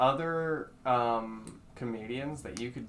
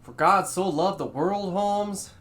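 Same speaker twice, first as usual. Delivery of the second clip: louder, higher, preachy God voice with a George Lopez swagger; 6 semitones higher, 6 dB louder than usual. Acted. Friend asks for George Lopez as God, preachy loud. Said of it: "what he would say as God".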